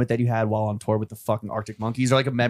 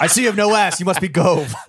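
mock [name]; 5 semitones higher, 4 dB louder than usual.

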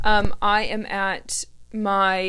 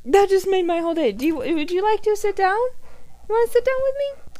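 dog voice; 11 semitones higher than usual. Real, not acted. Host greeting her dog Holly who came to say hello, dog voice. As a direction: coo at a beloved dog in a much higher voice. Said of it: "having to hear my dog voice".

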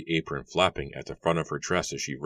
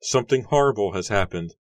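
high, loud and urgent; frightened plea; 5 semitones higher, 4 dB louder than usual. A narrator's reading. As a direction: beg her to come, shaken. Frightened girl pleads.

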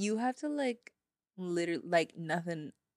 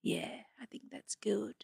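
whispering; 3 semitones lower, 4 dB quieter than usual. Pretend. Plotting quietly in store.

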